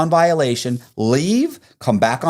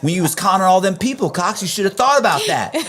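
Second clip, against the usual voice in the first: mock taunt; 7 semitones higher than usual. Taunting an inmate leaving.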